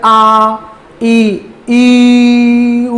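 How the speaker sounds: आ ई उ each stretched out long; teacher shows vowels can be held. Shown as a demonstration. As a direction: hold each vowel as long as breath allows. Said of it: "दीर्घ भी बोल रहे हैं देर तक".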